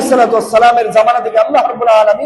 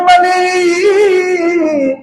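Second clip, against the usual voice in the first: long-drawn high wail of one word; crying out to God; 6 semitones higher, much slower than usual. Acted. Angels crying out to the Lord, drawn-out call. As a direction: stretch the call out, high and falling. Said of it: "চিৎকার করে মালিককে ডাক দিয়ে বলেন".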